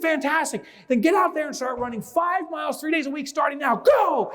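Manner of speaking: high, hyped-up coach sending runners off; mock drill command, pitched high; acted rallying shout. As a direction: fire them off the start line, building to a loud go. Researcher ordering runners off.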